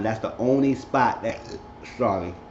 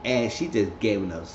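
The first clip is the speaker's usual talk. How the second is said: ordinary delivery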